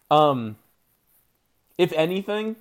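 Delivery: usual speaking voice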